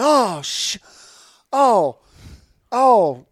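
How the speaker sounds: high, loud gasping oh; mock shock, cutting off a curse; hammed-up amazement; stunned. Stranger stunned by the name.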